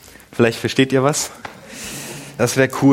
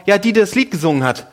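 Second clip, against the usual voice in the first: put-on higher, small child's voice asking; imitating young son; 7 semitones higher, faster than usual. Son calling to his dad from the back seat, higher child voice.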